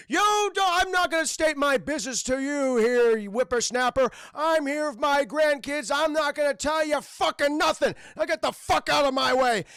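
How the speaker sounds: put-on loud, high, ranting old-man act; Aang as cranky old man. Boy bluffing the guards as a grandfather, hammed-up cranky shout. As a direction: rant like an indignant grandpa. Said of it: "old man".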